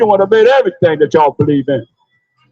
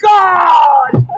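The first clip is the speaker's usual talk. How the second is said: mock terrified scream; 8 semitones higher, much slower than usual. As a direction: wail it like someone caught in a disaster. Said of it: "They be saying".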